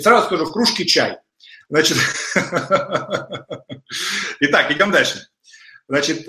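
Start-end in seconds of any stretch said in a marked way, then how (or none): 2.0-4.3 soft laugh. Real, not acted. Laughing mid lecture.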